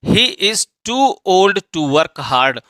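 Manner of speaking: very slow, word by word; deliberate classroom reading pace; slow dictation. Shown as a demonstration. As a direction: spell it out slowly for students. Teacher reads example sentence.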